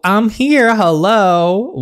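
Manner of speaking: high, loud call for attention. Acted. Captive girl to her abductors who ignore her, calling out. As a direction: call out indignantly from the back.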